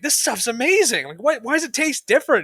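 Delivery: high, loud amazed voice; wide-eyed amazement; amazed gushing. Retold or imitated. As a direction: gush about the taste like it's a miracle.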